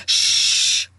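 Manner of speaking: sharp hushing shh; shushing. A narrator's reading. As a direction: hush them sharply. Harry hushing them.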